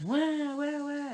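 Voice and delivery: high, loud mock baby wail; imitated baby crying. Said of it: "my baby was constantly crying".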